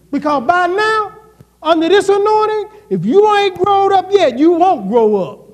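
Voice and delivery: preaching at full force. Acted. Pastor urging the church to grow up, loud and high. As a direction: drive it home, full fire.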